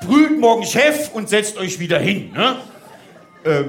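ordinary talk

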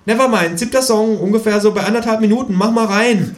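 high, brisk kangaroo instructions; high-pitched, bossy kangaroo; insistent kangaroo. Acted. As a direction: rattle off the track details in a high voice. Kangaroo figure instructs in story.

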